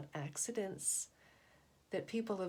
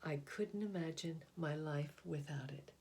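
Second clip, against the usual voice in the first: put-on gentle storybook narration for children; picture book reading. A narrator's reading. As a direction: read it like a bedtime picture book. Reads picture book aloud.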